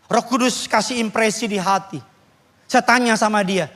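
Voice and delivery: usual speaking voice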